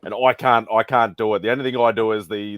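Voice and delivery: normal talking voice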